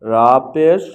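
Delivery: loud, slow, measured syllables with pauses between; letter-by-letter recitation drill. Shown as a demonstration. Teacher showing learners how to pronounce pesh, in a loud recitation drill. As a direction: call out each letter and its vowel slowly and loudly.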